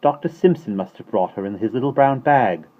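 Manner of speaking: put-on confident little-girl correction; knowing child. A narrator's reading. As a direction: explain it like a big sister.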